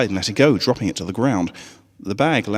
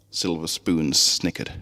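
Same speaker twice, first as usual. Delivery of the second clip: plain reading voice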